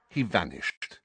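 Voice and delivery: ordinary delivery, nothing marked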